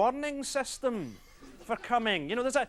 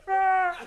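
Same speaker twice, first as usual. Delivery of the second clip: a big high, loud collective groan; crowd groan; 9 semitones higher, 6 dB louder than usual. Retold or imitated. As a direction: moan like a whole stadium. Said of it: "forty thousand people all together going".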